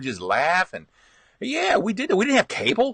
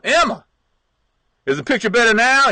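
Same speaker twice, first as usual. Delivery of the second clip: louder, higher call from the roof; 7 semitones higher, 4 dB louder than usual. Retold or imitated. Dad on the roof calling to mom, loud and high. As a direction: shout down asking about the picture. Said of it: "He'd tell my mom".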